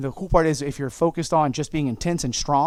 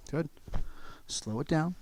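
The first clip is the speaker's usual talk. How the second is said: slow and soft; 9 dB quieter than usual. Shown as a demonstration. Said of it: "I slow it down".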